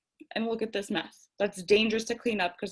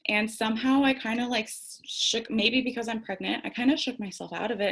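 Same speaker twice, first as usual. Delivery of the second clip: ordinary talk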